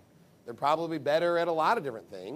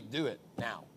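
stern boss; 6 semitones lower, 7 dB quieter than usual. Retold or imitated. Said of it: "when they come to work".

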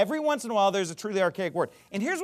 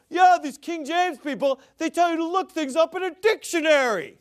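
high-pitched mock complainer; mocking imitation; 8 semitones higher, 4 dB louder than usual. Preacher quoting the guy in his video, mocking and high. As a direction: imitate an indignant critic, pitched well up. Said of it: "and the guy literally said, he's like".

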